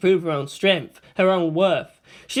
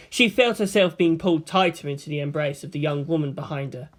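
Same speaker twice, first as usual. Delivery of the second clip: ordinary delivery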